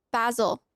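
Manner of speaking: Basil as BAZ-ul; short a in Basil, not ay; British pronunciation. Shown as a demonstration. Streamer testing the fox's name, Basil as BAZ-ul. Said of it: "If you say basil is basil".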